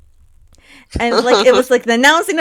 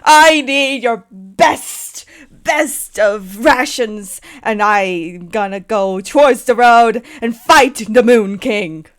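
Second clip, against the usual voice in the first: grand knight; 5 dB louder than usual. Acted. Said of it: "the farmer just sighs".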